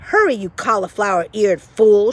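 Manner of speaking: loud insulting shout; Cook shouting. Acted. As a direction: yell the insult. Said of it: "shouted Cook".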